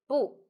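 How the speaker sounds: 不 in its original falling tone. Shown as a demonstration. Teacher shows learners 不 keeping its original tone, falling. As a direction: say 不 with its own falling tone. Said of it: "Keep the original pronunciation 不".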